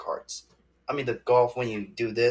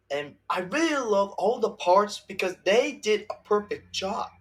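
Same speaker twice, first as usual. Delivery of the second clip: ordinary talk, nothing marked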